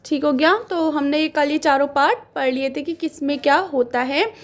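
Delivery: ordinary talk, nothing marked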